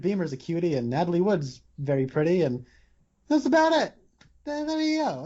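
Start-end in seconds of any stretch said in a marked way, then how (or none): 3.3-5.3 very high, airy put-on voice; mock gushing. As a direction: toss it off high and silly. Mocking West Side Story's thin romance.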